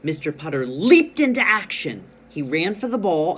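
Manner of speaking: loud, lifted storybook excitement; excited reading. Shown as a demonstration. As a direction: read it with a burst of action. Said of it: "read with ex-, excitement".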